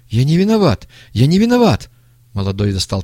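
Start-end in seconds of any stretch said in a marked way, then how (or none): 0.1-1.9 loud, high-pitched cries of innocence; panicked protest. A narrator's reading. Young man drawing a pistol, protesting in panic. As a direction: repeat it louder and higher, desperately.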